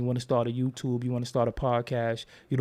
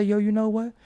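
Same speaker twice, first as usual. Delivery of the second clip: pumped up; 8 semitones higher than usual. Retold or imitated. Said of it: "and I say".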